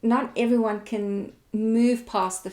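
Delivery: ordinary talk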